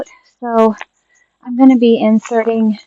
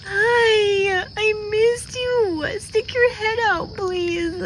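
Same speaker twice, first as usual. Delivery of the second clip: much higher, crying through the words; real crying; 11 semitones higher, much slower than usual. Real, not acted. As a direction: sob out a relieved welcome. Crying while greeting returned pet.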